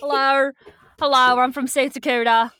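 put-on loud, jokey South Dakotan accent; mock South Dakotan accent. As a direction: announce yourself in a made-up South Dakotan accent. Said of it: "This is my South Dakotan accent".

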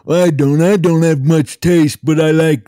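high-pitched goofy character voice; Ben and Jerry character. Acted. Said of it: "It's Ben and Jerry".

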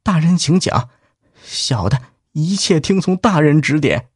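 respectful. Acted. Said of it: "毕恭毕敬地说".

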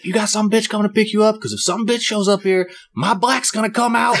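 hammed-up angry woman shouting at her man; imitating angry woman. Angry woman threatening rival.

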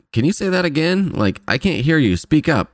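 demanding she speak up; impatient old doctor. Retold or imitated. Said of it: "So she's always like".

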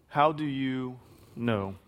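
"How do you know?" drawn out slowly, each word weighed; slow, searching repeat. Shown as a demonstration. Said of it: "H-, how do you know?".

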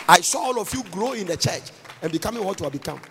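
usual speaking voice

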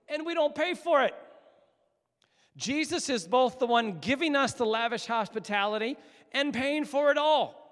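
high, loud sermon climax; impassioned preaching. Real, not acted. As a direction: proclaim it to the whole room with full force. Preaches the climax at full force.

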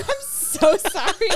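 high, drawn-out sorry breaking into laughter; slow, high-pitched laughing retold apology. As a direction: apologise helplessly through a laugh.

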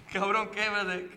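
ordinary talk

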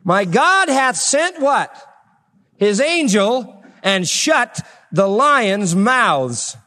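very slow, high, drawn out; slow testimony. Acted. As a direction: lay each word out slowly.

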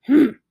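short, loud snorted "hmm". A narrator's reading. Uncle hearing the children's answer, loud snort. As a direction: snort through a closed mouth. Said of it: "he snorted again".

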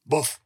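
loud barking boof; dog woofing loudly at the visitor; gruff bark. Acted. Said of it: "In a strange, gruff voice".

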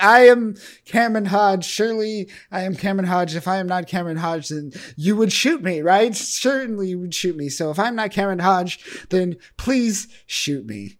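loud mock existential meltdown; Cameron Hodge. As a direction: plead loudly as if losing your mind. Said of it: "like, existential madness".

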